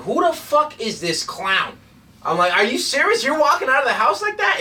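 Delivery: high, loud disbelief; can't believe what he's seeing; incredulous. Retold or imitated. Recalling his reaction to a Texan in a huge hat, high incredulous. Mocking a cowboy's outfit.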